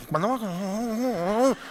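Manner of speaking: drawn-out bored moan, rising at the end; impatient listener dragging it out slowly; slow whiny impatient complaint. Retold or imitated. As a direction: whine it like a fidgety churchgoer. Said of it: "y ya".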